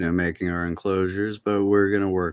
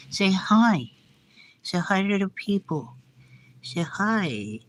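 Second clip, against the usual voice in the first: pet talk; 9 semitones higher, slower than usual. Real, not acted. Coaxing tortoise to greet viewers.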